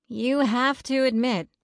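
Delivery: slow, hesitant admission. A narrator's reading. Melody admits the spookiness to friends, slowly. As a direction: concede it slowly. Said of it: "Melody said slowly".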